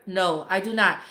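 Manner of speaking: ordinary delivery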